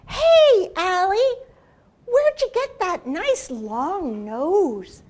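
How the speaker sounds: high, loud call; calling Turtle. Acted. Turtle calling out to Ally, high and loud. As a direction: call out cheerfully to the alligator.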